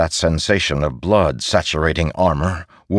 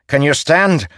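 loud high urgent question; urgent shout; 9 semitones higher, 4 dB louder than usual. Acted. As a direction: call it over the din. Soldier urgent on battlefield.

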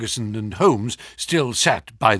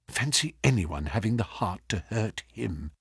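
put-on father muttering over the hurt baby; 2 semitones lower, 9 dB quieter than usual. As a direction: say it under his breath over the baby. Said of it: "he muttered".

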